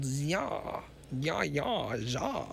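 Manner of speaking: mock German "ya, ya, za". Shown as a demonstration. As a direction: try out a cartoon German accent. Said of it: "So he has that, like, stereotypical".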